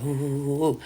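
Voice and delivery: low, soft shivery ooh sounds; shivering moans. Retold or imitated. Sick woman shivering in the bathtub, low shaky moans. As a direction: moan like you're shaking with chills.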